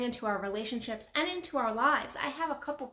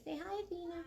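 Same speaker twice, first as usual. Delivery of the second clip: higher, softer coaxing pet voice; talking to the cat; 7 semitones higher, 10 dB quieter, faster than usual. Real, not acted. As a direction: coo it to the cat so she greets us.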